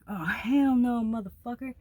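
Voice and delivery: loud, high retold outburst; indignant retort. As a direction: act out indignant disbelief, hitting it hard at the start. Retells defiance after being hit.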